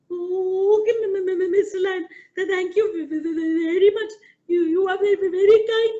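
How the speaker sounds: a tiny mouse begging with chattering fear; frightened mouse pleading with the lion; stammering scared mouse. Acted.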